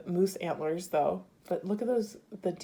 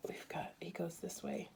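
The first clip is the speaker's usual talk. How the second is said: hushed, much softer aside; whisper; 13 dB quieter than usual. Real, not acted. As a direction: murmur it to yourself under your breath. Turning the bead aside.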